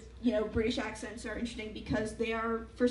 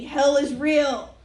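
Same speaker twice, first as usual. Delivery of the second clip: loud, very slow mock proclamation; drawn-out, earnest doom announcement, hammed up; giant sincere lettering; 3 semitones higher, 11 dB louder, much slower than usual. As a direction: boom it out like huge block capitals. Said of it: "giant, sincere, blocky letters reading".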